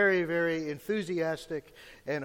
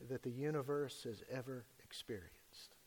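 hushed whisper; 3 semitones lower, 9 dB quieter than usual. Acted. Dramatic drop on despair.

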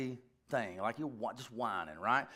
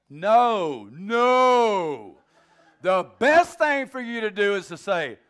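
loud, high preaching; emphatic refusal; 4 semitones higher, 11 dB louder, slower than usual. Real, not acted. Preacher rejects coddling grown men, emphatic and loud. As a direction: belt out the refusal.

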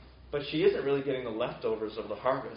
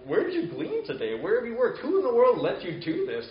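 rising, louder, amazed questions, each one higher; acted astonishment climbing with every question; astounded questions; 9 semitones higher, 5 dB louder than usual. Naomi astonished at Ruth's haul, asking higher and louder. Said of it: "Naomi's a bit astounded".

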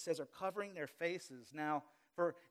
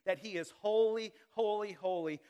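holy, holy, holy louder and higher; emphatic holy; 4 semitones higher, 8 dB louder than usual. Shown as a demonstration. Preacher explaining seraphim praise, emphatic and loud. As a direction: build the holy with force. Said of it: "that's what these seraphim are doing".

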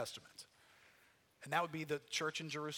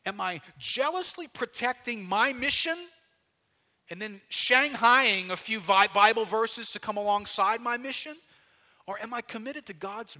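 rising preaching fervor; 6 semitones higher, 8 dB louder than usual. Real, not acted. Preacher presses the point.

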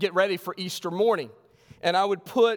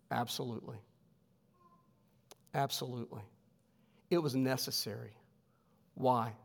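quiet emphasis; 4 semitones lower, 8 dB quieter than usual. Real, not acted. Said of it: "quiet emphatic answer".

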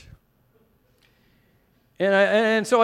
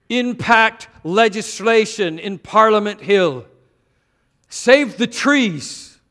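preaching; 8 semitones higher, 8 dB louder than usual. Acted. Preaches a list of slogans.

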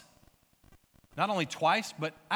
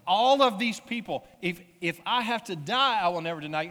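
loud, high, acted protest; bold vow; 3 semitones higher, 6 dB louder than usual. Disciple vowing never to deny Jesus, loudly insistent. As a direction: proclaim it as an over-emphatic promise.

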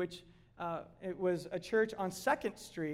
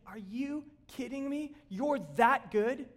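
higher, slow, wide-eyed astonishment at grace; joyful disbelief; 6 semitones higher, 5 dB louder than usual. Pretend. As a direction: marvel out loud like a kid on Christmas morning. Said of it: "we're just gonna be like".

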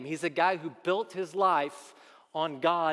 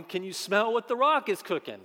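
Rock catchphrase; 5 semitones higher, 5 dB louder than usual. Retold or imitated. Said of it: "For the millennials who watched wrestling".

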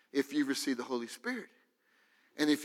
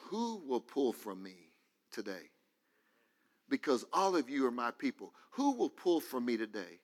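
softer, gentle invitation to be touched; quiet inviting Jesus; 5 semitones lower, 5 dB quieter than usual. Acted. Preacher voicing Jesus inviting the crowd, soft and quiet. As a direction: murmur it as an open invitation.

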